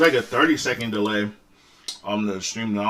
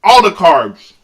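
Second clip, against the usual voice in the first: mouth full; 7 semitones higher, 11 dB louder, much slower than usual. Real, not acted. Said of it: "I don't normally talk with my mouth full".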